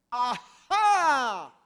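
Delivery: loud gotcha cry; triumphant ajá. Acted. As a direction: pounce with a loud triumphant shout. Pharisee catching Jesus out.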